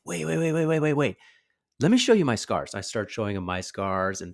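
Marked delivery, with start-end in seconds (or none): 0.0-2.7 high, loud rapid-fire reassurance; hurried, raised-pitch cutting in; urgent interruption. Retold or imitated. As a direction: re-enact eagerly stopping a kid mid-complaint. Urgently stopping upset child.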